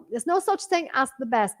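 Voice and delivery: ordinary talk, nothing marked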